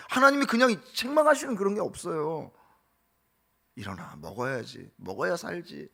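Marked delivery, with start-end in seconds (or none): 3.7-5.9 soft, low, coaxing voice; gentle coaxing. Acted. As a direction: wake him tenderly like a parent. God tenderly feeding Elijah.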